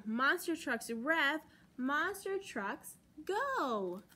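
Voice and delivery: ordinary delivery, nothing marked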